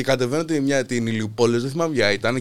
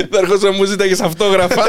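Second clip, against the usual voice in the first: higher voice breaking into laughter; laughing retold tease; 5 semitones higher, 4 dB louder than usual. Guest retelling his rapper friend's teasing, laughing. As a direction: quote the tease while cracking up.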